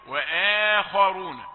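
وآخرون drawn out, light hamza, emphatic kha; correct Quranic recitation. Shown as a demonstration. Teacher models for students, slow and careful. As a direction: recite وآخرون slowly and cleanly. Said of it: "ثم تدخل على الخاء المفخمة بيسر".

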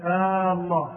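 long "آه" opening "الله"; lengthened hamza. Shown as a demonstration. Teacher illustrates the quoted ruling, first hamza lengthened. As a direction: stretch the first vowel of "الله". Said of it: "تعمد مد الهمزة من لفظ الجلالة".

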